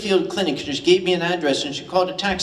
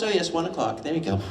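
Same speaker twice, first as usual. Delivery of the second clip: softer, offhand British murmur; blasé British locals; 6 dB quieter, faster than usual. Retold or imitated. Edinburgh locals checking watches after the cannon, softly blasé. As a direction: shrug it off like it's nothing. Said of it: "everybody looks at their watch, says".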